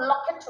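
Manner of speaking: "लॉकेट" with a short open o; "लॉकेट", o kept short; short o sound. Shown as a demonstration. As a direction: say "लॉकेट" with a brief aw-like first vowel. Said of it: "difference है short sound long sound".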